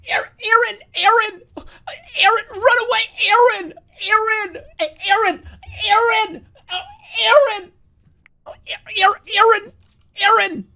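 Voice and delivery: high crying shout of Aaron; anguished shouting Aaron. Retold or imitated. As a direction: scream the name in anguish, high and loud. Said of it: "Now do the Aaron impression".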